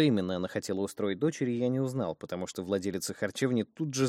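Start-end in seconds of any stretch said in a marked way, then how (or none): none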